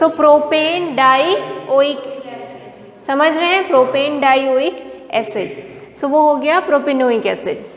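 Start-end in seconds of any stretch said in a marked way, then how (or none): 0.0-2.0 very slow, each syllable drawn out for notes; slow dictation. Shown as a demonstration. Teacher dictating a compound name. 3.7-5.6 very slow, each syllable drawn out for notes; slow dictation. Shown as a demonstration. Teacher dictating a compound name.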